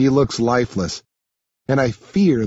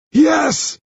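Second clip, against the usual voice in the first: high, desperate shouted surrender; strained high-pitched outburst; pained yell; 4 dB louder than usual. Acted. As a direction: cry out under a painful hold. Pinned bully cries out in pain.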